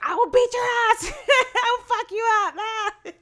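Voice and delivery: loud, high mock threat; mock tough threat. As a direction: yell it like a tough guy. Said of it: "And she was like".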